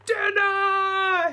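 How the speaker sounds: put-on high, very loud giant-baby shout. Giant baby shouting for dinner, very loud. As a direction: roar for dinner. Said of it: "he cried".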